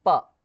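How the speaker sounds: प with lips springing open and a puff of air; aspirated p. Shown as a demonstration. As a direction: let the lips burst open on प. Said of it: "होंठ ... खुल जाता है". (होंठ खोलकर हवा के साथ प)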